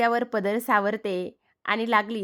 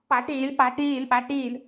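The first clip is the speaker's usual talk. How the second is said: higher-pitched repeated call; urgent calling; 4 semitones higher, slower than usual. Acted. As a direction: call out for the chief with some urgency. Man runs up calling for Patil.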